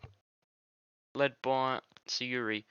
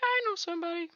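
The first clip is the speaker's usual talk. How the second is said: high cutesy voice; faster than usual. Retold or imitated. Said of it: "Siri's gonna probably be like".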